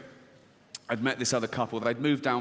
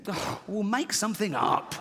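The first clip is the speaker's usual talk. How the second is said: scheming father; 7 semitones higher than usual. Acted. Punchline, father's voice.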